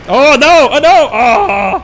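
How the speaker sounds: loud alarmed cry, high; panicked shout. Real, not acted. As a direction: yell it in alarm. Monster flees, panicked outcry.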